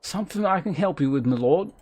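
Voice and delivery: quick low-class British servant voice; low-class accent, addressing a lord. Acted. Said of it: "you'd read with that voice".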